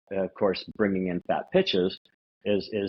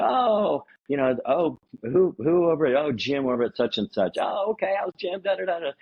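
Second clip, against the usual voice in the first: bright, higher put-on friendly chit-chat; mock chatty small talk; 7 semitones higher than usual. Salesman greets prospect about mutual friend Jim, higher mock cheer. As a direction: play the overly friendly caller.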